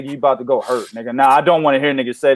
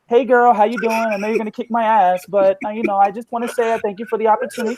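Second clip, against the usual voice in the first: lightly raised, overly sweet fighter voice; lightly higher mock-nice boxer thanking her rival; mock polite fighter; 6 semitones higher than usual. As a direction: play the meek, grateful opponent, a bit higher.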